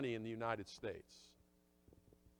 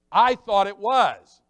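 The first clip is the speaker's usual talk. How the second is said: emphatic loud confession; 5 semitones higher, 15 dB louder, slower than usual. Acted.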